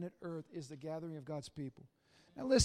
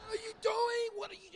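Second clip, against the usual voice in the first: much higher, shouted then fading; startled shout; 13 semitones higher than usual. Retold or imitated. Father catching his son biking round the casket, shocked shout. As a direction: yell at the kid in disbelief.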